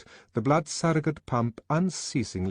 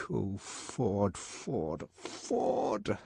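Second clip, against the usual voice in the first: softer, ending in a breathy cry; anguished groan; 6 dB quieter, much slower than usual. A narrator's reading. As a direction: despair rising to a gasped cry. Bernard in anguish.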